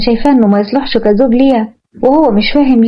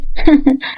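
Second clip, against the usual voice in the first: short laugh; 2 semitones higher than usual. Acted. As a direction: let a small laugh slip. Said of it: "ابتسمت مروة وقالت".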